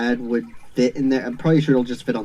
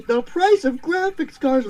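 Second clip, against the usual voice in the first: high, laughing repetition. Real, not acted. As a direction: repeat it through laughter. Laughing repeat of joke.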